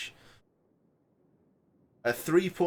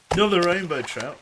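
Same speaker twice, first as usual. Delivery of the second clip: excited; 6 semitones higher, 7 dB louder than usual. Shown as a demonstration.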